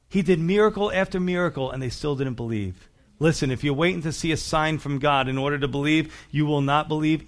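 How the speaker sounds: usual speaking voice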